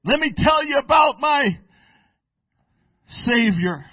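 loud, high, ringing preacher's cry; preaching at full force. Acted. Preacher rousing his congregation, loud ecstatic preaching. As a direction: whoop it up like a revival preacher.

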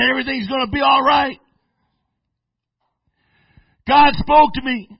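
loud, high, joyful declaration; excited reassurance. Retold or imitated. Pastor assures the grieving sister, loud and joyful. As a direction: proclaim it with triumphant joy.

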